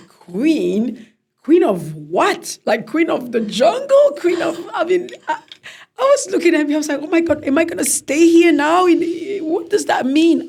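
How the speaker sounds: loud, high disbelieving questions; incredulous. Retold or imitated. New queen disbelieving her title, loud and incredulous. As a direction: blurt out stunned questions.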